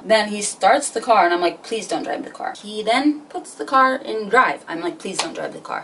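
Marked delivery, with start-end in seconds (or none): 1.6-2.5 much softer, hushed begging; small, hushed mock plea; quiet retold plea. Retold plea to tripping driver. 5.0-5.8 low, much softer begging; hushed, lowered mock pleading; quiet retold plea. Same plea, repeated as he shifts.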